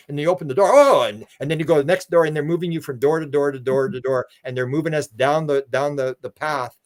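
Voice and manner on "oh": sudden loud, high "oh"; amazed exclamation. Acted. Person opening the door on wonders, mock amazed. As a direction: burst out as if startled by a discovery.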